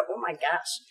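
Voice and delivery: low, hushed retold gasp of awe; awed aside. Woman invited back to meet the priest, hushed awe. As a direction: say it softly, under the voice.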